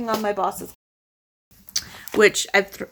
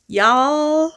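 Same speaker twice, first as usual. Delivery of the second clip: a quick Southern "Y'all"; Southern twang on "Y'all"; Southern drawl; 5 semitones higher than usual. Acted. Vlogger slips into Southern for Nashville news, Southern accent. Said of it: "I don't know why I just went Southern".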